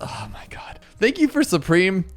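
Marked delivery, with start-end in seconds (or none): 0.0-0.8 whispering. Real, not acted. Exhausted relief after winning.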